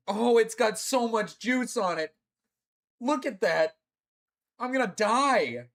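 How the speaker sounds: high, alarmed cry at the pain coming; alarmed wail. Real, not acted. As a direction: raise the pitch, wailing in real dread. Dreading the painful juice.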